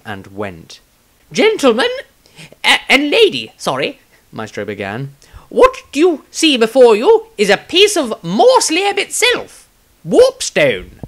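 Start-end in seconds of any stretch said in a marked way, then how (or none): 1.3-4.0 high, grand speech voice; pompous lecturer. Acted. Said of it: "his knowledgeable lecture". 5.5-11.0 high, grand speech voice; pompous lecturer. Acted. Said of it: "his knowledgeable lecture".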